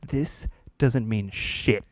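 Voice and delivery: slow, deadpan put-down; slow flat dismissal. Retold or imitated. Boss to job applicant, slow and deadpan. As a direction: drag the words out coldly.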